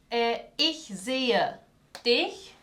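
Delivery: slow, careful German ich sehe dich; German example phrase. Shown as a demonstration. Teacher modelling German for Spanish-speaking students, slow and clear. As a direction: say "ich sehe" slowly, then "dich" rising. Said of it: "Yo te veo".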